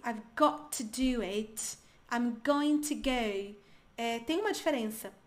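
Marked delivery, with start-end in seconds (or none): 0.1-3.5 slow, careful British pronunciation; British accent. Shown as a demonstration. Teacher demonstrating British pronunciation of some words, slow British accent. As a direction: draw out each word in British English. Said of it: "em termos de pronúncia mesmo".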